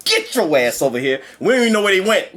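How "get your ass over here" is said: mock furious villain. Villain grabbing the heroes, mock furious.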